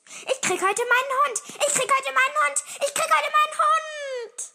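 very high, loud, rapid chant; frantic childish glee; overexcited shouting. A narrator's reading. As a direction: yell it like an ecstatic little boy. Boy bursting with joy about dog.

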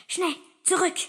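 high, loud urgent command; alarmed call. A narrator's reading. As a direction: urge a hasty retreat. Unicorn urging a hasty retreat.

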